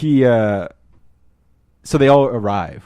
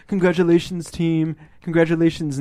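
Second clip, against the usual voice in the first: lightly acted boss, pitched up; a hint of put-on cheer, higher; mock congratulations; 6 semitones higher than usual. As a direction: imitate a boss hailing the team. Imitating the boss congratulating the team.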